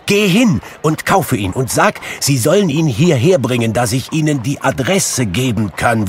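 high, loud eager instructions, easing off; joyful, urgent calling; excited Scrooge, giving orders. A narrator's reading. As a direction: send the boy off eagerly.

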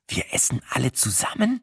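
soft, forced through a crooked grin; gritted-teeth murmur. A narrator's reading. Narrator grits out to Sina through a grin, softly. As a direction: grind it out quietly between the teeth. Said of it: "knirschte ich".

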